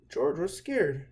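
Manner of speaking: usual speaking voice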